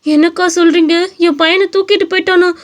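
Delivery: high, alarmed cries of disbelief; a mother just told her child is gone; panicked mother. Acted. Mother hears son was kidnapped.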